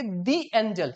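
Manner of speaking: दी with a long ee leading into एंजल; "thee" before a vowel. Shown as a demonstration. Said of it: "जहां पर भी vowel sound produce हो रहा है".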